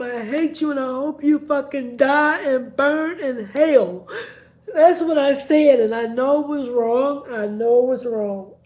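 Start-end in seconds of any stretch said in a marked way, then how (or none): 0.0-4.1 loud, tearful hate-filled yell; retold angry outburst. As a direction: spit it out at him through tears. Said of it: "I told him".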